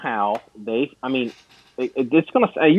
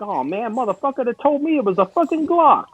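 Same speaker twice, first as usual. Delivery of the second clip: high put-on dopey whine; squeaky dumbfounded guy cursing the seller; dumb customer voice; 11 semitones higher than usual. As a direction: play the dimwit who got duped, pitched way up. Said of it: "the guy literally is like".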